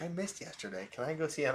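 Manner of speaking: put-on other kids. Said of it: "other kids would be like".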